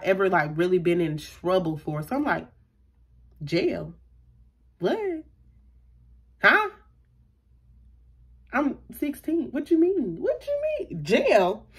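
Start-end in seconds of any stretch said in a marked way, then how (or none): 3.4-6.7 baffled one-word questions with long pauses between; stunned, acting out the shock of the news; retold disbelief. Teen told she is under arrest, stunned disbelief. 8.5-11.7 high, squeaky baffled questions; retold disbelief. Sixteen-year-old facing jail, incredulous.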